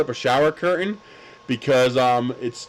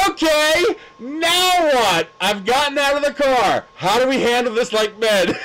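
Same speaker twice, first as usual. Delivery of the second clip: loud challenge; 10 semitones higher, 3 dB louder than usual. Retold or imitated. Confronting the man, arms spread.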